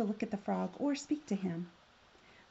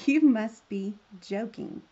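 loud, slow scornful snap; 8 dB louder, slower than usual. A narrator's reading. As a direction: scoff right at the frog. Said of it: "she snapped".

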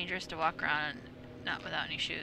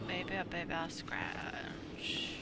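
slow soft whisper; 2 semitones lower, 10 dB quieter, much slower than usual. Real, not acted. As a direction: drop to a breathy whisper, slowly. Repeats words then whispers them.

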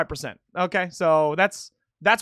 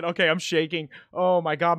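usual speaking voice

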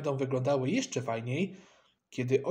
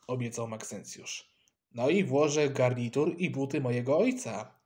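usual speaking voice

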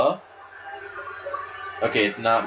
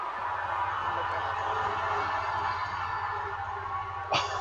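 soft breathless laugh; 11 dB quieter than usual. Real, not acted. Reacting to a crazy move.

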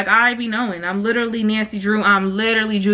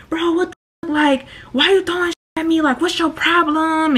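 higher, outraged guy yelping at her; shocked boyfriend; 6 semitones higher than usual. Retold or imitated. Boyfriend reacting to thrown mac and cheese, higher and outraged. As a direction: play him stunned and offended.

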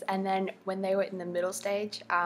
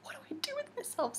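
breathless high squeal; 8 dB quieter, faster than usual. Retold or imitated. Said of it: "and you're like".

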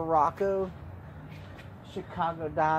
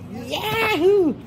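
high whooping cheer; excited yahoo; 6 dB louder than usual. Real, not acted. Vlogger cheers as the food order begins, high whoop. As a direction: let out a high happy whoop.

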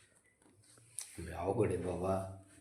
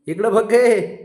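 bright coaxing call; 10 semitones higher, 7 dB louder, much slower than usual. Real, not acted. Coaxing child to look.

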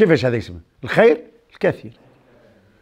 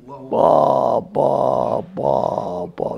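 low drawn-out exclamation, trailing softer; admiring "ba ba ba"; 4 semitones lower, 3 dB quieter, much slower than usual. Real, not acted. Preacher marvelling at Abu Hurayra, low admiring exclamation. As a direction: marvel with slow, fading "ba" sounds.

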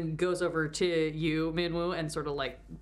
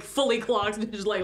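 ordinary talk, nothing marked

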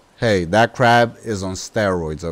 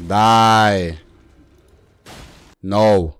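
ordinary talk, nothing marked

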